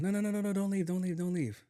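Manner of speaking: high, rushed no no no; urgent plea. Retold or imitated. Lee on the phone begging him to stay, high and urgent. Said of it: "and he was like".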